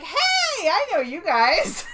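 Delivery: high, loud, slow happy greeting; light up like meeting old friends; delighted recognition. Retold or imitated. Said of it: "she's like".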